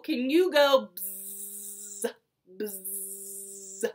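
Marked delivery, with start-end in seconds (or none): 0.9-2.1 low, sustained buzz; imitated bumblebee. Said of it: "like a bumblebee". 2.6-3.9 soft, low, held buzz; imitated bumblebee. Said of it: "like a bumblebee".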